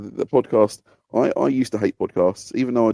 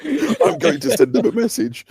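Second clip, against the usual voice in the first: high, slow, hammed-up indignation; mock indignant rant; 4 dB louder than usual. Listener ranting at a podcast guest on the radio, hammed-up indignant. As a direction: play up the fury at the radio, half joking. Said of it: "sit shouting at the radio".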